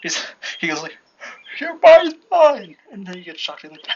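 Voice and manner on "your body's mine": very loud, high, drawn-out villain cry; villain's attacking shout. Acted. Sludge villain attacking Deku, acted triumphant shout. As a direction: yell it like a monster seizing prey.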